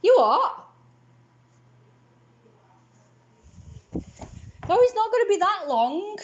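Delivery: high loud shout to another room; shouting back. Real, not acted. Answering mum from another room, loud high shout. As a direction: yell back across the house.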